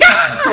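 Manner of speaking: very high, loud startled scream; loud high shriek of fright; retold shriek. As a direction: shriek as the trap snaps. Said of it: "I'd be like".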